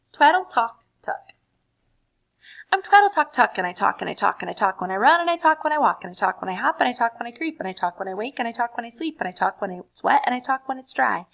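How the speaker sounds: loud fast chatty chant; chatterbox. Acted. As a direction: rattle it off like a nonstop talker. Rapid chatterbox poem.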